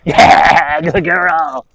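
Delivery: high, loud praise for the dog; bright dog-praise voice; excited praise. Real, not acted. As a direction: cheer her on loudly. Trainer praising dog after fetch.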